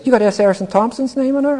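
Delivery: high, incredulous question; surprised professor. Retold or imitated. Roman history professor surprised at the mentor's signature, high and incredulous. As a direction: lift the pitch high in disbelief.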